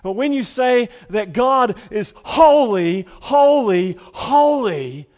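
full shout, high and loud on each holy; crying out holy with all your force. Shown as a demonstration. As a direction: proclaim it at the top of your voice. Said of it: "now you're really shouting it".

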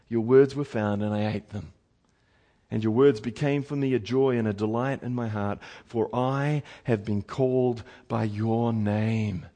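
low, soft, slowing right down; reverent recitation. Acted. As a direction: recite it as a hushed, weighty confession. Preacher recites Jeremiah's reply reverently.

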